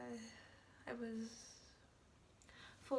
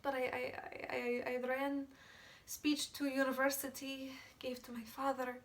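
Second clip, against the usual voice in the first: loud, stammering urgency; frantic and loud, tripping over repeated words; agitated, panicked recounting; 8 dB louder than usual. Acted. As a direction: tumble through it, breath catching, voice raised. Agitated account of frantic rush.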